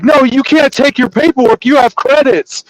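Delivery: high, insistent mock protest; retold refusal in a high, agitated voice; indignant clerk. Acted quote of clerk arguing.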